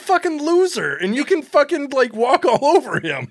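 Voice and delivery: high, loud, gleeful taunt; gleeful mocking. Real, not acted. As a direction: cackle at the loser manager. Mocking the manager laughing.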